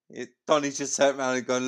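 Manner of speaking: normal talking voice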